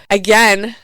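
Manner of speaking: ordinary talk, nothing marked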